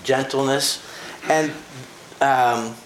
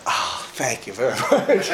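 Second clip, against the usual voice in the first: laughing thanks. Retold or imitated. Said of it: "And I thought".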